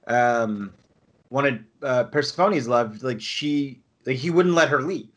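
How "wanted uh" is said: ordinary talk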